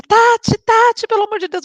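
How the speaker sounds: high, loud call across the bathroom; calling friend Tati from the work bathroom, high loud shout. Retold or imitated. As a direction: yell for your friend, desperate and high. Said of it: "Eu saí gritando".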